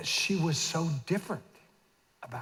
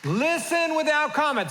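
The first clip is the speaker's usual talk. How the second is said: high, loud call-out to the room; 11 semitones higher, 5 dB louder than usual. Shown as a demonstration. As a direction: lead the men, belting the line. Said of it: "Say it, guys".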